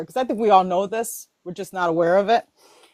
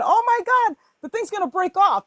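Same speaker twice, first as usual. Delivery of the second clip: worried mother; 10 semitones higher than usual. Retold or imitated. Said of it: "my mother going".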